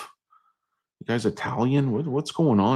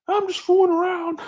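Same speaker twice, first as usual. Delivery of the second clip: very high put-on voice; brother's excuse. Brother excusing his horseplay, high mock-innocent voice. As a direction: protest innocence in a squeaky falsetto.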